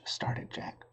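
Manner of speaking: usual speaking voice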